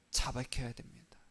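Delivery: hushed urgent whisper. Acted. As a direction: breathe it to the congregation like a secret. Pastor stresses confession.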